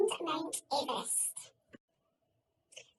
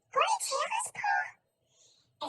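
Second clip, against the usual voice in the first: very high, breathy little plea; small child voice; 14 semitones higher, much slower than usual. Acted. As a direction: beg like a tiny child. Child asks father in story.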